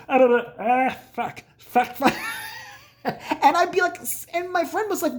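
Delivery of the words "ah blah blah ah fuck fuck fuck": imitated dad. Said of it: "And my dad's like".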